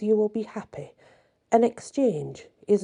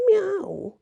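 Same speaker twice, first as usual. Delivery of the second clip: high meow; cat meow; 12 semitones higher than usual. Acted. Cat chairman finishing its report, high meow.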